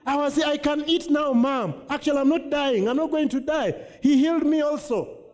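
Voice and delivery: high, bright testimony of healing; excited daughter. Retold or imitated. Preacher voicing the healed daughter telling her mom, high and excited. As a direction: burst out that you're healed, pitched high. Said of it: "She's like".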